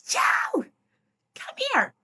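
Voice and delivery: high sing-song call across the house. Retold or imitated. Sister Meg calls Jo from a room across the way, high call.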